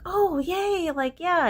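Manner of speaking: high-pitched mock-excited cheer; imitated kids' cheer. Kids hearing their mom has a place, imitated high cheer.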